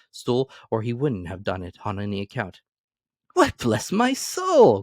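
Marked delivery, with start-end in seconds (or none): 3.3-4.8 high, slow, amazed exclamation; startled nephew crying out in wonder; astonished cry. Acted. Fred startled by his uncle at the door, astonished cry. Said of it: "cried Fred".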